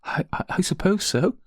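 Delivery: hesitant, high-pitched stammer; tearful murmur. A narrator's reading. Crying maid admits her trouble to the lady, tearful murmur. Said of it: "she murmured".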